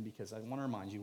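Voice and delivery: ordinary delivery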